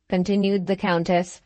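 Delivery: plain reading voice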